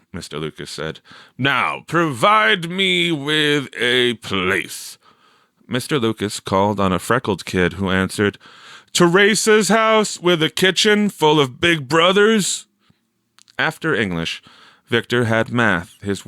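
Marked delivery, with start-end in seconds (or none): 1.4-5.0 higher, slow teacher prompt; teacher's voice. A narrator's reading. Mister Lucas asking the class, slow and higher. 8.9-12.7 put-on high, louder child's voice; kid's answer. A narrator's reading. Freckled kid answering the teacher, high and loud.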